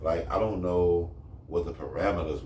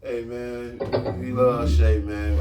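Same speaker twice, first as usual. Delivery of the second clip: loud; 5 semitones higher, 7 dB louder, much slower than usual. Real, not acted. Backpedals loudly.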